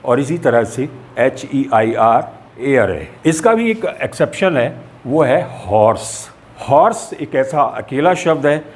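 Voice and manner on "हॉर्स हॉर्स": हॉर्स with a clear h at the start; हॉर्स, h pronounced rather than silent; H sounded. Shown as a demonstration. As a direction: breathe out the h that opens हॉर्स. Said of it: "एच जो है वो है की आवाज़ निकालता".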